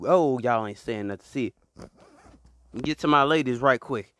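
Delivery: ordinary delivery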